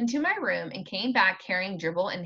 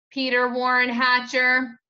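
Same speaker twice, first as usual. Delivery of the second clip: louder full-name warning; louder mock-stern mother; 4 semitones higher, 6 dB louder than usual. A narrator's reading.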